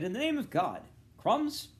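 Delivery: high, loud rising outrage; scandalised at the crumbs; indignant surprise. Acted. Cunningham finding crumbs, high and indignant.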